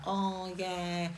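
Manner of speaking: soft, offhand sheepish admission; sheepish retort. Retold or imitated. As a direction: play it down quietly, a bit sheepish. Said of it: "And I was like".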